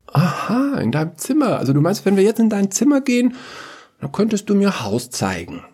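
high, singsong feigned discovery; coaxing father. Acted. Father coaxes secret from daughter, high playful questioning. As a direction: pitch leaps up, playfully leading the child on.

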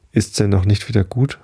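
ordinary delivery, nothing marked

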